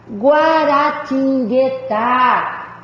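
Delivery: "guaratinguetá" very slowly, syllable by syllable to a long "tá"; stress on final syllable. Shown as a demonstration. Teacher showing there is no maximum of syllables, very slow "guaratinguetá" with final stress. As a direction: spell out "guaratinguetá" in stretched syllables, landing on "tá". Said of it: "sílaba tônica está na última".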